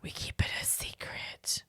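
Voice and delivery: hushed, playful whisper; barely-there conspiratorial whisper; secretive whisper. Pretend. Jokingly whispers about a secret.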